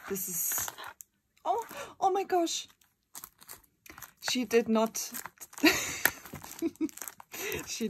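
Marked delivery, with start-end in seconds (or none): 1.4-2.7 sudden high squeal of surprise; high, loud shock at the gift; surprised gasp. Real, not acted. Opening a fan's gift, squeals in surprise.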